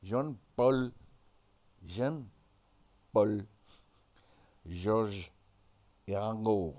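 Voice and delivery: low, loud mock-French roll call; French accent. Naming the Beatles as in Frogland, mock French accent. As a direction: announce each name in a deep French accent. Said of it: "what they would have called the Beatles".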